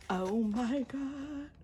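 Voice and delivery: very slow and high; high drawn-out oh my God; stretched-out squeal. Real, not acted. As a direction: draw it out in giddy awe. Awed, drawn out.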